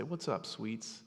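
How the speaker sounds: hushed, comforting dad voice; tender father. Retold or imitated. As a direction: gently check on a frightened child. Father soothing child at night.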